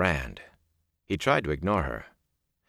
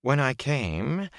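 high, very slow and cold; 7 semitones higher, much slower than usual. A narrator's reading. Liandrin reports what she found, in a cold voice. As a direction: draw it out coldly. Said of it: "Liandrin said in a cold voice".

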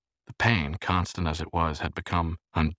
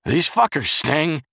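high, loud, slow yell; pained, complaining shout; 10 semitones higher, 7 dB louder, slower than usual. A narrator's reading. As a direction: yell it through a door. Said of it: "Charles yelled right at the door".

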